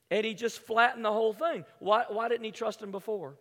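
ordinary talk

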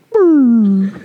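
loud falling "brrr"; imitated deflating noise. Hero suddenly deflated by a nickname, loud falling noise. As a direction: make a loud noise of sinking down. Deflated after the girl's taunt.

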